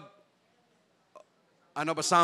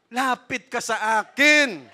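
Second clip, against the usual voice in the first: "Lapit ka sa akin" said louder, higher and slow, every word drawn out; rising plea; 5 semitones higher, 6 dB louder, slower than usual. Shown as a demonstration. Preacher urging the congregation to come to God, slow loud plea. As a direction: plead "Lapit ka sa akin" slowly and loudly, pitch lifted. Jesus' invitation, repeated louder later.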